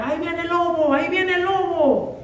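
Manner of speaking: high, playful fake alarm cry; a shepherd boy calling out as a prank; mock shout. Shepherd boy pranking the villagers, high mock alarm. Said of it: "empieza a gritar".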